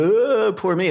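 high, whiny mock wail of self-pity. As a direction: ham up a poor-me moan. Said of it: "I'm not gonna start crying".

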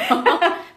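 a loud, rising laugh bursting out; burst of laughter. Real, not acted. Friends joking about stickers, laughing out loud.

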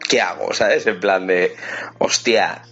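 usual speaking voice

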